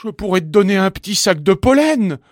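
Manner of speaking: high, loud, pleading offer; desperate bargaining. A narrator's reading. Stuck bumblebee offering the bee pollen, desperate.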